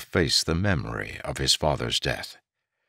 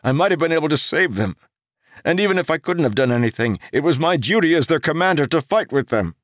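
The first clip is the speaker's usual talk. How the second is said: higher, louder, fast tumbling words; urgent guilty outburst; 11 semitones higher, 5 dB louder, faster than usual. A narrator's reading. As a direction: pour out the guilt in a rush.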